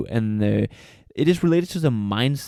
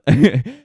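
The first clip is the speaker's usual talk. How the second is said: a quick bright burst of laughter, louder; short laugh; 5 semitones higher, 7 dB louder than usual. Real, not acted. Host laughing after greeting his Mauritian family, short laugh. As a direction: laugh at your own shout-out.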